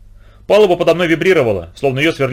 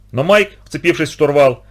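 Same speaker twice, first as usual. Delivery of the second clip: ordinary delivery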